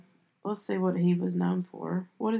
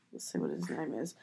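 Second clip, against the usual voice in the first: muttered aside; 9 semitones lower, 13 dB quieter than usual. Real, not acted. Aside while looking up name.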